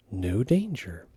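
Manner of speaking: ordinary delivery, nothing marked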